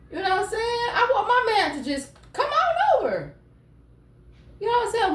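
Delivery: put-on high, pleading girlfriend voice; voicing a needy girlfriend. Tarot reader voicing a lonely Gemini woman, high and pleading. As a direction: whine it like a lonely partner.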